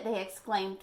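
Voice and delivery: ordinary delivery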